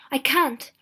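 recoil, building to a loud no; horrified thought. A narrator's reading. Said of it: "he thought".

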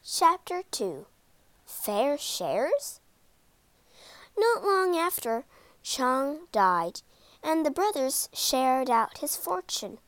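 plain reading voice

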